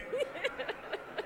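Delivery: laughing through the megaphone; loud laugh. Real, not acted.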